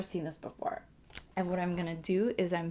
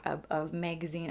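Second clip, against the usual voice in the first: ordinary delivery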